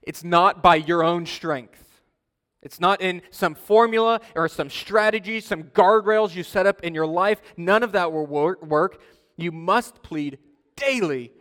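loud, emphatic pulpit delivery; forceful preaching. Real, not acted. Pastor warns congregation against their own strength, forceful preaching. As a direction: drive it home to the congregation, loudly.

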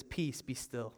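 soft, low command imitating Jesus; calm authority. Jesus stands up to the waves, calm authority. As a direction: quietly order it, fully in control. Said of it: "with all authority he says".

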